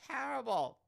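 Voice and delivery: high-pitched mock British accent; attempted British accent. Host mocking own mistake, high attempted British accent. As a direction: throw out a hammy, high British pronunciation. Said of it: "I would try to do a British accent".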